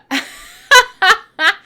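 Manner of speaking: high loud burst of laughter. Real, not acted. Host laughing at her own lack of charm, loud laugh. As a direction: crack up at yourself. Laughing at failing to lure chat.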